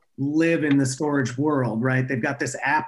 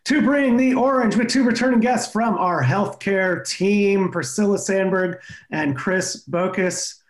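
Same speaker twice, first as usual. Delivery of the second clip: upbeat show opener, higher pitch; podcast host intro; 5 semitones higher than usual. Acted.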